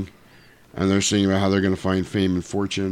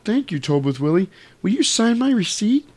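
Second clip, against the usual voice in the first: higher-pitched polite request; sweet lady customer; 11 semitones higher than usual. Retold or imitated. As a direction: ask sweetly, climbing in pitch. Lady customer being sweet to Willie.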